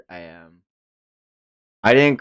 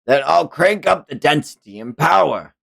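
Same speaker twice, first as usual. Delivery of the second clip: high, loud scornful jeer; mocking villain; 6 semitones higher, 3 dB louder than usual. Streamer voicing the villain scolding Julian, scornful shout. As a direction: jeer at his reckless opponent.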